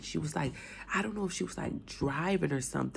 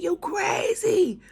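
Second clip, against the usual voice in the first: much higher, louder and slow, screaming it out; mother screaming; 13 semitones higher, 8 dB louder, much slower than usual. Retold or imitated. Mother screaming at her daughter for giving things away, drawn-out scream. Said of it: "She was screaming".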